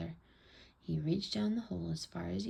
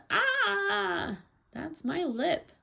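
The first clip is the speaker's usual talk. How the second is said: long, loud, very high "ah"; high acted wail, words drawn out; yelling in pain; 12 semitones higher, 6 dB louder, much slower than usual. A narrator's reading. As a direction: scream it like a child being yanked. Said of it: "Allie yelled".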